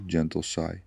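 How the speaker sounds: ordinary talk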